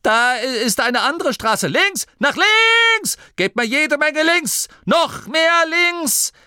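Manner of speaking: very high urgent yells; panicked, drawn-out commands; frantic shouting. A narrator's reading. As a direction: shriek steering orders at the crew. Frantic steering orders in runaway truck.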